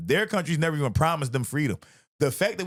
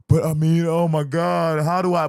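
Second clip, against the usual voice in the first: louder mock-whiny panic; mocking whiny coward; 6 dB louder than usual. Mocking scared people who won't stand up, whiny. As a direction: whine like someone too scared to act. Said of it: "we sit here like".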